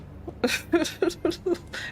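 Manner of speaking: high, breathless awkward giggle; an awkward little nervous laugh. Real, not acted. Laughing at herself, awkward nervous giggle. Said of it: "an awkward, nervous laugh".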